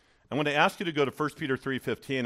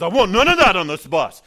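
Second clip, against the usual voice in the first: stern warning; 7 semitones higher, 14 dB louder than usual. Retold or imitated. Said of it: "He turns around and says".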